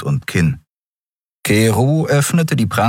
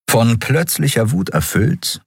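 usual speaking voice

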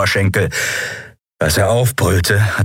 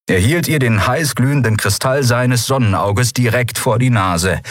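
plain reading voice

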